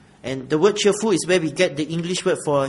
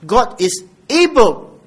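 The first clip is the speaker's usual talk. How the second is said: loud, higher-pitched sermon delivery; emphatic preaching; 5 semitones higher, 7 dB louder than usual. Real, not acted. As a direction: drive the promise home to the congregation. Preaches emphatically at full force.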